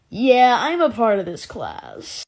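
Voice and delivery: loud, drawn-out mock freshman voice; stretched-out, loud put-on claim; freshman impression. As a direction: imitate a freshman, slowly and loudly. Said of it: "this freshman walks in, he's like".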